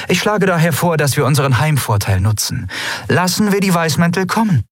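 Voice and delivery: high, cool commanding empress; an empress calmly laying out her plan. Acted. Voices the empress Lien.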